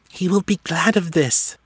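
high-pitched little female elf. A narrator's reading. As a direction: pitch it well up, as a small elf confiding in her companion. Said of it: "he heard the female say".

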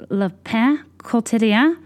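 "Le Pain Quotidien" slowly, in a put-on French accent; French accent, drawn out, unsure rising end; mock French accent. Saying the café name in French to a friend, careful French accent. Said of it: "you have to be like".

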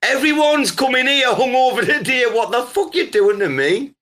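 high, hammed-up indignant rant; mock scolding. Mock scolding of hungover guest.

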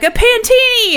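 high, loud mock announcement; gleeful high-pitched ta-da; triumphant reveal. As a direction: crow it like a grand punchline. Acting out the man's big reveal.

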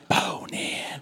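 breathy whispered echo; echoey whisper. Retold or imitated. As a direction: echo it back as a sultry whisper.

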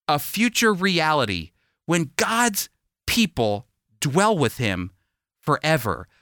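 ordinary talk, nothing marked

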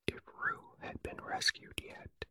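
ordinary delivery

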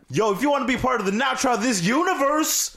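high hype radio host intro. Pretend. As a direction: pump it up like a DJ shout-out. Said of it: "Let me try one".

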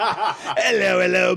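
high, hammed-up Cockney goon; Cockney accent. Goon greeting Holmes, over-the-top Cockney accent. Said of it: "like, Cockney accent".

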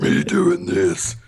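breathy, slow cartoon monster; vintage cartoon voice. Shown as a demonstration. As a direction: ham up a silly cartoon monster. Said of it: "sounds like somebody doing a cartoon voice".

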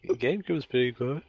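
fast, bored dinner-guest voice; Adam Owen impression. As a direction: rattle it off like a dull guest. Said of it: "he's just saying".

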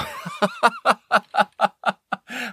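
short high-pitched laugh. Real, not acted. Laughs at own joke.

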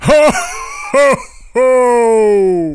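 loud, jolly Santa laugh; belly-laugh like Santa Claus. Pretend. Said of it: "let's try it".

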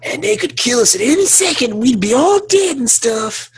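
whiny high mock tirade; mock yelling. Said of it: "Homegirl's yelling in his face".

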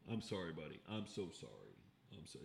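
very soft, low apology to a dog; hushed, tender and low; soothing apology. Real, not acted. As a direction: murmur sorry to an upset pet. Soothes his dog off mic.

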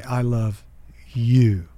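"you" set off by a pause and landing a bit firmer; "I love", pause, then a firmer "you"; third way of saying it. Shown as a demonstration. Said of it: "Or I can say".

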